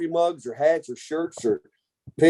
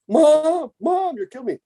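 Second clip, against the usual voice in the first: high pained begging, pitched way up; pleading whine; 11 semitones higher than usual. Retold or imitated. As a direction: beg Mom to stop like a hurting kid. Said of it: "I'm going".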